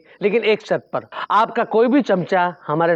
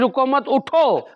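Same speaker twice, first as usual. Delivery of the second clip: "रुको मत" together, drawn-out "उठो"; pause after मत; 4 semitones higher, slower than usual. Acted. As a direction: slowly urge "रुको मत", break, then "उठो". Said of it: "हमने आपसे ये कहा कि". ("रुको मत" जोड़कर, फिर खींचकर "उठो")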